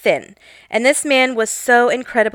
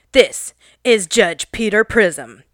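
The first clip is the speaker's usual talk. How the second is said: pretend TV judge; 2 semitones lower, slower than usual. Mock courtroom judge voice.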